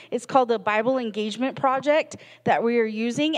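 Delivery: usual speaking voice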